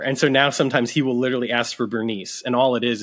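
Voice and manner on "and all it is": ordinary talk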